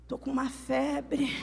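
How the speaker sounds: weak, hushed mock-sick complaint; feverish man whining. Preacher mimicking men with flu, pitiful soft whine. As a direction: complain feebly, milking the illness. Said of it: "Homem com gripe".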